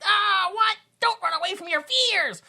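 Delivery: high, mocking imitation of an actor; mocking his voice. Mocking the movie actor's line, high mocking voice. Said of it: "That's actually a spot-on voice of him".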